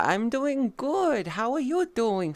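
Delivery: high, slow sing-song answer; high drawn-out friendly reply; sweet reply. Real, not acted. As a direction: drawl it sweetly to the chat.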